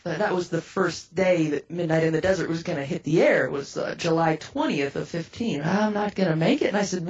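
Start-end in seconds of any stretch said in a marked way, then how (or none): none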